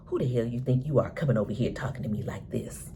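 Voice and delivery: hissed threat, all breath; menacing whisper. Retold or imitated. Duda warning Trig off, in a hiss.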